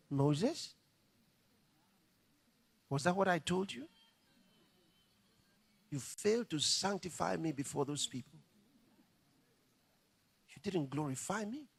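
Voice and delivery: a soft, grave reproach; stern God. Retold or imitated. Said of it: "God said".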